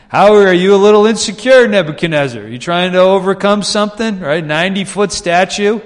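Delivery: loud, high, laughing taunt; mocking incredulity. Preacher ribs Nebuchadnezzar over his giant statue, mocking and incredulous. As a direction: tease the absent king with amused disbelief. Teasing the king in mock address.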